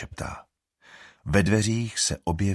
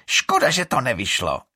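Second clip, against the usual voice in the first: high, loud spiteful shriek; 5 semitones higher, 6 dB louder than usual. A narrator's reading. Iris shrieking spite at Alrik. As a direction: screech it back at him. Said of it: "zaječí Iris".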